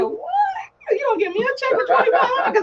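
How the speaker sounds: high laughing disbelief at the check; stunned disbelief. Retold or imitated. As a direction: gasp it out in shocked joy.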